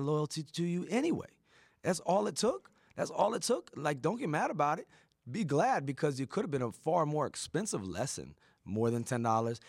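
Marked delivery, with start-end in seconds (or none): 0.0-7.3 higher and louder, driving it home; fired up. Real, not acted. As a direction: preach it with rising fire. Passionate motivational climax.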